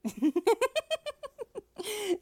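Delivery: high, loud rippling giggle; long giggle that falls at the end. Acted. Teasing a partner, playful high giggle.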